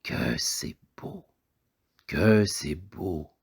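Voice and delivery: slow, hushed wonder of a poor father; a father murmuring in slow admiration; father's awed admiration. A narrator's reading. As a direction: marvel slowly, half under your breath. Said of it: "Les yeux du père disaient".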